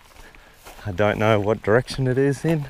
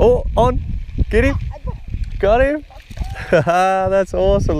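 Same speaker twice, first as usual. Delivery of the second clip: high, loud whoops at a strike; excited shout; 11 semitones higher, 4 dB louder than usual. Real, not acted. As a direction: cheer the fish onto the hook. Fish striking the lure.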